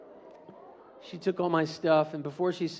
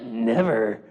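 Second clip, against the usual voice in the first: low bully taunt; 8 semitones lower, 4 dB louder than usual. Acted.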